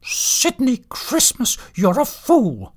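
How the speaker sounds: high, slow cutting rebuke; cross, high-pitched telling-off; displeased scolding. A narrator's reading. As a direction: scold him as a fed-up wife. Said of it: "She was not pleased".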